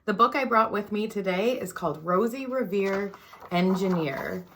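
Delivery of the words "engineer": usual speaking voice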